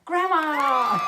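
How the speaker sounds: loud, high Grandma call; joyful cry. Acted. Pete spotting Grandma from the train, loud happy call. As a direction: shout her name with joy.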